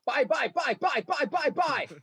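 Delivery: high, chanting buy buy buy; man doing a woman's voice. Retold or imitated. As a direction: mimic a wife chanting buy. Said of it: "my six-year-old daughter does an impression of my wife".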